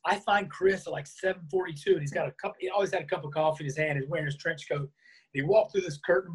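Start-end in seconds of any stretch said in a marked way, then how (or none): none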